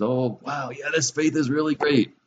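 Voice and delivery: mock enthusiasm. Preacher voices someone thrilled by the wonders of faith, mock-excited. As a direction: play the impressed new believer. Voicing an impressed hearer of faith.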